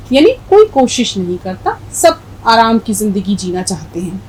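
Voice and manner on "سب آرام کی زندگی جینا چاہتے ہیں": ordinary talk, nothing marked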